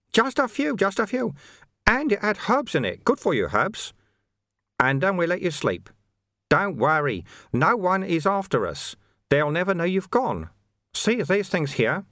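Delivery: high, lilting monk's reassurance; placating monk. Acted. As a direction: play a monk airily brushing off worries. Sweeper soothes Vimes.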